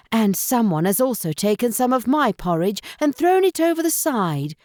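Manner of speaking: fussing storybook mother bear; mother bear finding her porridge spilt, lightly voiced; Mother Bear's middle tone. A narrator's reading. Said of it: "said Mother Bear in her middle tone".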